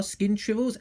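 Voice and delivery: ordinary delivery, nothing marked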